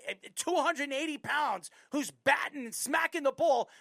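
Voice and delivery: normal talking voice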